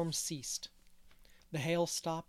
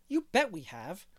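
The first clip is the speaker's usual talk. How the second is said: put-on a light, cheerful little-boy voice, a bit louder; cheerful piping boy; 4 semitones higher, 5 dB louder than usual. A narrator's reading. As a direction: pipe up brightly like a relieved child. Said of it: "piped Davy cheerfully".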